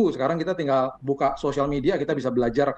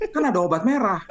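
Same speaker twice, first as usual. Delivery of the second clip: carefree; 5 semitones higher than usual. Retold or imitated. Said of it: "saya katakan".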